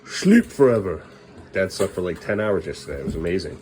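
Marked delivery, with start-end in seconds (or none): 0.0-1.0 loud, playful burst; mock exclamation. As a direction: blurt it out in comic exaggeration. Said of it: "we're just like".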